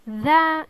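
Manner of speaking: loud "that" with buzzed th; voiced th. Shown as a demonstration. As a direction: punch out "that" with a voiced th. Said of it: "pronounced like the T H".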